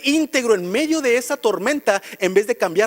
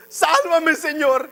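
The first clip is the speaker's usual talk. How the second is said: súplica aguda y exclamada; clamor de auxilio actuado; 5 semitones higher, much slower than usual. Retold or imitated. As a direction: imita a quien pide socorro en plena crisis. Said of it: "ya están diciendo". (clamor agudo imitado)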